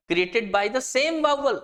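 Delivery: slow, deliberate dictation pace; slow definition reading. Shown as a demonstration. Teacher reads a definition so students can copy it, slow dictation. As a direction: read it out word by word for students to note down.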